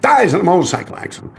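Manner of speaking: ordinary talk, nothing marked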